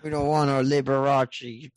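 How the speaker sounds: slow, drawn-out rodeo-crowd objection; stretched-out mock cowboy protest; rodeo local. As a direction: play a disapproving cowboy, dragging the words.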